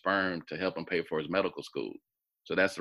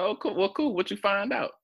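retold eager high questions, pitched well up; excited; 14 semitones higher than usual. To the half brother on the phone, an eager high-pitched retold question. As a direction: perk up high and curious at the news. Retelling a surprising call.

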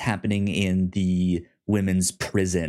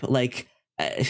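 usual speaking voice

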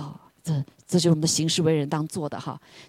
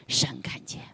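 hushed whisper; 5 semitones lower, 9 dB quieter than usual. Acted. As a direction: breathe the words 神看见. Hushed emphasis repeated. (低声耳语)

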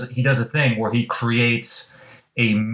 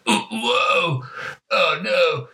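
high, panicky wail; panicked cowardly voice; 11 semitones higher, slower than usual. Retold or imitated. Yajirobe watching Goku get crushed, high panicky whine. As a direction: squeal it like a scared coward.

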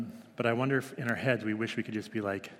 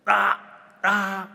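put-on high, loud little-kid "ah ah" of protest; toddler protest; 10 semitones higher, 9 dB louder than usual. Toddler stopping her parents' chat on a walk, loud high protest. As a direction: cut the talk off like a cross toddler. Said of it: "puts her hands like this and goes".

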